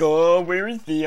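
slow, dopey mock voice; mock clueless. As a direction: play the oblivious character dumbly. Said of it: "and you think".

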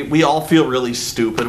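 ordinary talk

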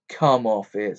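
ordinary delivery

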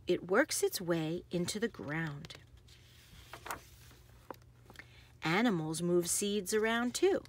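lively, sing-song picture-book read; bouncy storybook narration with playful sound words; reading to children. Acted. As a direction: read it like bedtime story time for little kids. Reads picture book aloud.

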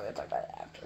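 a hushed whisper. Real, not acted. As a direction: breathe it secretly. Same words whispered after.

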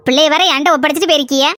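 usual speaking voice

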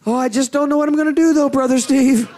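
mock the worried church member; hammed-up despair to the pastor; whiny doubter. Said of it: "You're gonna stop talking like that?".

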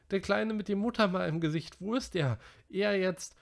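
put-on high, anxious boy's voice; Piggy frightened. A narrator's reading. Piggy searching for the missing little boy, high and alarmed. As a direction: ask in rising alarm.

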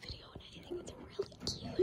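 hushed unvoiced whisper. Real, not acted. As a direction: whisper so the seeker can't hear. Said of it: "because I have to whisper".

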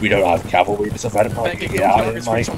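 lightly voiced Australian bloke rallying his mates; easygoing Australian accent, mate-heavy; a touch of Aussie accent for a cheeky squad leader; Australian officer. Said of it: "it's Australian Ted".

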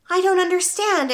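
high-pitched puzzled schoolboy; bewildered child, voice lifted high; little boy. A narrator's reading. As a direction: pipe up in confusion. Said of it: "Eric said".

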